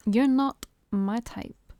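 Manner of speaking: each word drawn out slowly; slow and emphatic. A narrator's reading. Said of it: "each word slow and emphatic".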